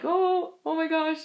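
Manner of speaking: high, startled oh my gosh; realizing she may have said something rude, startled; shocked gasp. Retold or imitated. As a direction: catch yourself in sudden alarm. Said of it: "was like".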